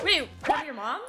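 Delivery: ordinary talk, nothing marked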